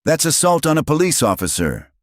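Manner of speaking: high, loud accusing shout. A narrator's reading. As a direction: yell it as an accusation. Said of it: "Briggs shouted anyway".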